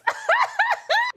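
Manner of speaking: loud, high-pitched cackle; real cackle. Real, not acted. Woman realizing we are the parents, cackling. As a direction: crack up at your own news.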